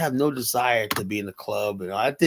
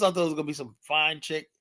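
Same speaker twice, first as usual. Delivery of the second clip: usual speaking voice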